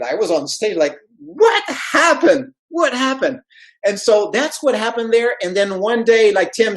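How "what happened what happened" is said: high-pitched, acted-out astonishment; incredulous, high re-enacted question; stunned disbelief. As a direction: replay your baffled amazement, pitched way up. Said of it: "I couldn't believe it".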